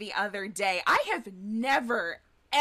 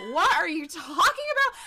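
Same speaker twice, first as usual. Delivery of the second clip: higher, louder incredulous question; mock disbelief; 6 semitones higher, 6 dB louder than usual. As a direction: exclaim it, playfully overwhelmed. Acts out disbelief at being praised.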